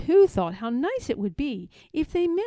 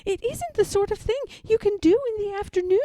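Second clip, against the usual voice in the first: higher, fast nervous Piglet; quickly; 10 semitones higher, 5 dB louder, faster than usual. A narrator's reading. Piglet refuses Pooh's offer, quick and high. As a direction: rush the excuse out. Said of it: "said Piglet quickly".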